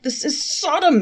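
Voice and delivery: high, loud outraged cry; a man crying out in shock; cried out. A narrator's reading. Traveler cries out at the bill, outraged. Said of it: "he cried".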